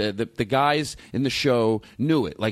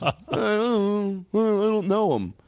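embarrassed guest; 7 semitones higher, much slower than usual. Retold or imitated. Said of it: "the people with us".

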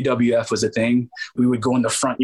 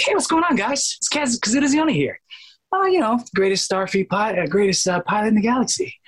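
much higher-pitched cartoon character voice; Kaz; 12 semitones higher than usual. Shown as a demonstration. Voice actor demonstrating his animated starfleet pilot, high raised voice. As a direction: raise the voice way up, introducing yourself as a pilot. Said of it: "And I raised my voice".

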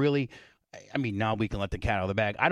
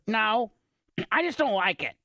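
whiny refusal; 10 semitones higher than usual. Retold or imitated. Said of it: "Tuttle refusing, retold".

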